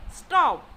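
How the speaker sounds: "stop" loud with a sharp fall; rapidly falling pitch on "stop"; command. Shown as a demonstration. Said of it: "like while saying a command".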